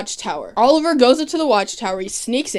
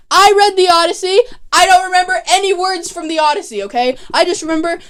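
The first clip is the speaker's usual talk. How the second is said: loud, high-pitched incredulous protest; indignant rant; 8 semitones higher, 5 dB louder, faster than usual. Real, not acted. Fan ranting about a plot hole, loud indignant protest. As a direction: raise pitch and volume in exasperated disbelief.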